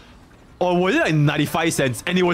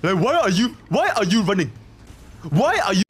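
higher-pitched imitation Nigerian accent; mock Nigerian accent; 4 semitones higher than usual. Showing friend the accent with a meme line, mock Nigerian accent. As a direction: put on a Nigerian accent, insistent. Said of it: "Like the Nigerian accent".

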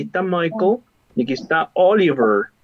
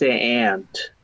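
"aunt" said American, like ant with a nasal a; 6 semitones lower than usual. Shown as a demonstration. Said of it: "if you go to America".

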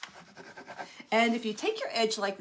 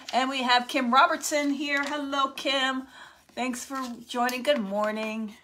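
excited greeting; 4 semitones higher, 4 dB louder than usual. Real, not acted. Spots a viewer joining live.

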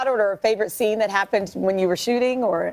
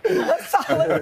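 talking through a laugh; 8 semitones higher, much slower than usual. Real, not acted. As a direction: still giggling as she speaks. Laughing while moving on.

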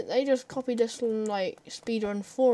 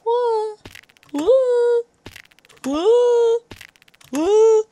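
high woos building louder each time; excited woos; 14 semitones higher, 11 dB louder, much slower than usual. Real, not acted. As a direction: cheer it up, louder and louder. Repeated woo, building.

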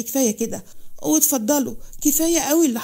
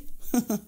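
short soft scoffing laugh; a quiet laugh of contempt; scornful laugh; 6 dB quieter than usual. A narrator's reading. Amir scoffs at his wife's claim of love, soft scornful laugh. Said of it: "بسخريه".

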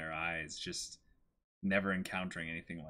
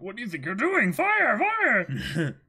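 high, loud hammed-up battle order; yelling a command to fire, high and loud; shouted command; 12 semitones higher, 8 dB louder than usual. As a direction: bark the order to the archers. Voicing a soldier commanding archers.

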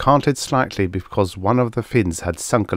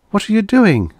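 high, louder character voice; Karen's alarmed question; 13 semitones higher, 5 dB louder than usual. A narrator's reading. Woman questioning Guthrie as he heads off, alarmed. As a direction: ask it sharply up high.

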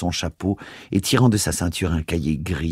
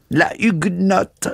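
much higher, slow sneer; 13 semitones higher, slower than usual. A narrator's reading. Priest jeering after the departing woman, high and slow. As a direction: spit out the word with scorn. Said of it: "ricana l'abbé Binot".

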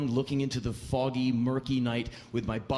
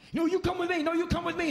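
much higher, insistent repeated pitch; pushy tout; faster than usual. Retold or imitated. Rival drivers grab the customer, high insistent calls. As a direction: keep pushing over the other drivers.

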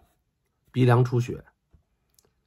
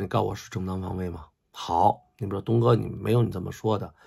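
ordinary delivery

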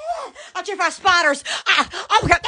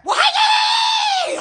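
much higher, slow, drawn-out hey there; sing-song greeting; much slower than usual. Acted. Greeting someone playfully, much higher and slow sing-song. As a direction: stretch it high and slow like a sing-song hello.

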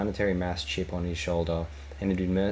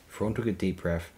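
ordinary delivery

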